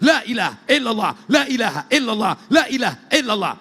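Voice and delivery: forceful, high rhythmic repetition; strong loud zikir. Shown as a demonstration. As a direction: pound out each phrase like a group zikir. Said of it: "orang yang berzikir kuat".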